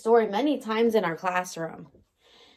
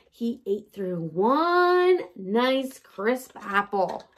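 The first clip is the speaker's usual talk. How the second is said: slow storytime reading; slower than usual. Acted.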